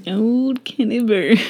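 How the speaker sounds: playful mock repeat, stretched out; mocking echo. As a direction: parrot it back slowly, hamming it up. Mocking echo of partner's phrase.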